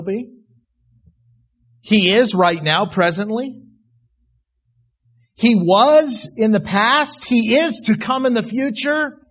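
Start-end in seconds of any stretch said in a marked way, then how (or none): 1.8-9.2 preaching at full force. Acted. Preacher building to a climax about eternity, loud. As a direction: build it up, hammering each phrase.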